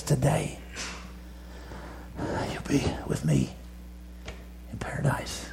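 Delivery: barely voiced, dying words; faint whisper from the cross. Dying Jesus speaks to thief.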